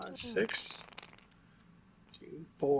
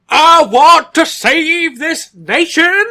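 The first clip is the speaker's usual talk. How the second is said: high, loud, slow fiery preaching; bombastic Southern preacher; 13 semitones higher, 11 dB louder, much slower than usual. Pretend. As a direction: boom it out like a revival preacher. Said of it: "bombastic would probably be".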